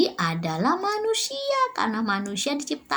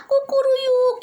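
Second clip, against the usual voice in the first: high, drawn-out cock-a-doodle-doo; rooster crow; 12 semitones higher, 4 dB louder, slower than usual. Shown as a demonstration. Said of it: "menirukan suara ayam kukuruyuk". (menirukan kokok ayam jago)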